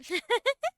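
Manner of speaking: short high-pitched giggle; a quick bright laugh. Real, not acted. Reacting on stream.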